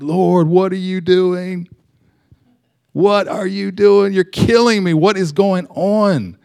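high, slow, overwhelmed cry to the Lord; retold panicked plea. College student on the dorm floor crying out to God, high and slow. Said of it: "I was, like, scared".

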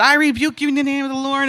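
high, rapid rebuke; retold yelling. Rebuking the devil alone in her room, quickly retold yell. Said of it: "I'm yelling in my room".

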